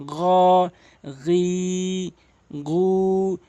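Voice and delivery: "গ, গি, গু" as throaty gh, vowels held long; guttural gh start on each of "গ, গি, গু"; ghayn articulation. Shown as a demonstration. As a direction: stretch "গ, গি, গু" evenly from the end of the throat. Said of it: "হলকের শেষ থেকে ... এক আলিফ টেনে পড়বেন".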